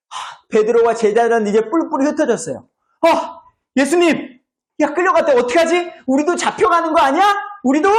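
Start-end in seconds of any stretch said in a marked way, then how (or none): 3.1-7.9 high-pitched acted alarm; hammed-up worried fluster; panicked disciples. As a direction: fret aloud that you are next. Acted panicking disciples, Jesus taken away.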